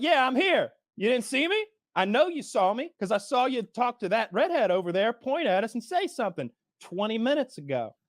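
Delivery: high-pitched, accusing retelling; indignant confrontation. Retold or imitated. Ignored diner confronting the waitress, indignant and high.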